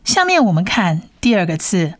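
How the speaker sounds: ordinary talk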